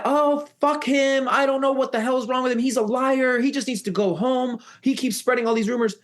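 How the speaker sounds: high-pitched, indignant imitated rant; angry retort. Annie dismisses the kid to the aunt, acted anger. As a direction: spit out complaints in a high voice.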